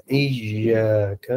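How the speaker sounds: ایاک slow, the ی soft, not hard; ایاک drawn out slowly with a gentle ya sound; soft ya in iyyak. Shown as a demonstration. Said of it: "ایاک یوں پڑھنا ہے".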